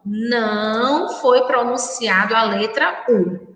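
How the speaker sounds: ordinary talk, nothing marked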